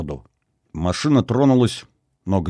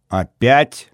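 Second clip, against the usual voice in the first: mildly angry, higher question; cross; 4 semitones higher, 4 dB louder than usual. A narrator's reading. Girl suspects he has healed someone again, cross. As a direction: challenge him with some annoyance. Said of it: "сердито нахмурилась девушка".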